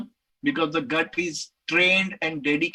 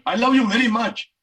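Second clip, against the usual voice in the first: louder, higher declaration of love; emphatic take; 4 semitones higher, 5 dB louder than usual. Shown as a demonstration. Telling his girlfriend he loves her, louder. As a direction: put some force into it. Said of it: "six different attempts I made".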